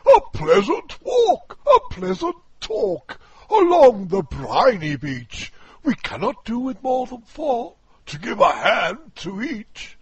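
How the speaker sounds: high, loud, booming walrus; a pompous walrus declaiming loudly. Acted. Walrus coaxing oysters.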